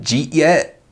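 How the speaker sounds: "Jeet yet" run together as one word; slurred "Jeet yet". Retold or imitated. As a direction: mash "Jeet yet" into a quick blur. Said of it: "one of the members said".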